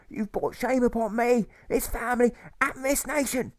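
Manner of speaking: a bitter mother shaming her son, pitched high and slow; hostile old mother. A narrator's reading. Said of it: "his mother says with hostility".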